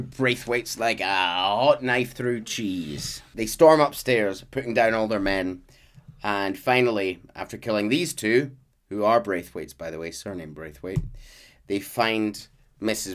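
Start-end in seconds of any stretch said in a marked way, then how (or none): none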